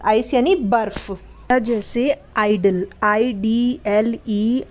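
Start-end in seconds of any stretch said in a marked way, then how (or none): 3.0-4.6 slow spelling. Shown as a demonstration. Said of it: "teacher spells example word".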